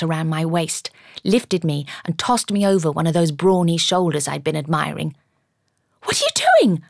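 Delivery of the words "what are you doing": high, loud outraged shriek; alarmed cry. A narrator's reading. Woman hoisted over a man's shoulder, crying out. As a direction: cry out in shock, pitch leaping up. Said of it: "I cried".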